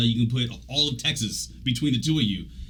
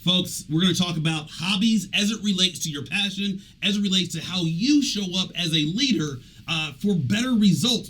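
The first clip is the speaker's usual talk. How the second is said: host address; 6 semitones higher than usual. Acted. Addresses podcast audience.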